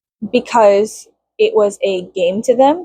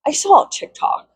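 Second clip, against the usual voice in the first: pitched up, mocking the TikTok excuse; mocking high repeat; 8 semitones higher than usual. Shown as a demonstration. Mocking a nosy stranger, pitched up. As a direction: echo it higher with scorn.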